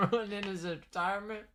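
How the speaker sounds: soft, high words through laughter; laughing. Real, not acted. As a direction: squeeze the words out while laughing. Laughing at chat's teasing.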